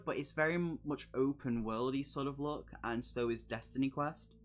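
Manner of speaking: normal talking voice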